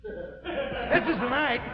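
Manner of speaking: high, slow, stretched declaration; threatening outburst. Acted. Husband works up to threatening wife, heightened. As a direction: lift the pitch and draw it out.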